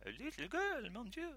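high-pitched acted French astonishment; French-accented wonder, pitched right up; astonished French scientist. Scientist peering into the sack at the girl, astonished French accent.

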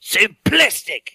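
put-on emphatic French-accented professor; hammy French accent, hitting the last word. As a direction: build to a banged-podium finish in a French accent. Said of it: "and he bangs the podium".

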